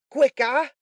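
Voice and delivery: high, very loud yell; impatient shout. A narrator's reading. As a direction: shout it to hurry him up. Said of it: "cried Sikes".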